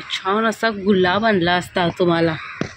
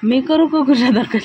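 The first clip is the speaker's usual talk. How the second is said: loud, higher teasing call; playful shouting; 5 semitones higher, 7 dB louder than usual. Real, not acted. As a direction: shout back in mock alarm, laughing.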